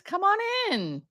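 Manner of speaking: lilting high invitation to join; sing-song welcome. Real, not acted. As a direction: come on in, swooping way up high. Welcoming viewers into the livestream.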